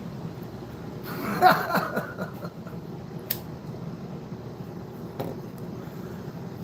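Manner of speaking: high burst of laughter, trailing off; real laughter. Real, not acted. Man joking about the whip, high laughter. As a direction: crack up at your own joke.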